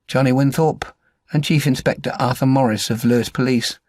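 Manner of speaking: ordinary delivery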